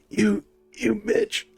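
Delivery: slow, high, pained groan; groaning injured man. Acted. Injured man to those around him, groaning. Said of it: "the guy groans and goes".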